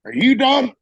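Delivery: high loud bark of a question; shouted demand. Retold or imitated. Gunny yelling at the lance corporal, high and loud.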